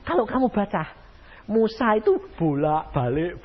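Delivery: normal talking voice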